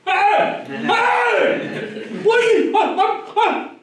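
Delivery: high, loud alarmed shouts across a field; full-voiced high-pitched yelling, retold. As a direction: imitate someone hollering "hey" at an intruder. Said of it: "he starts yelling".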